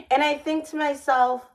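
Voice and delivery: normal talking voice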